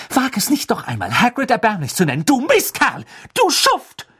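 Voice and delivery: furious outburst climbing to a high, loud yell; wütend. A narrator's reading. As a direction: hurl the insults at him, rising. Hermine slaps and insults Malfoy. (wütend, immer höher und lauter schimpfend)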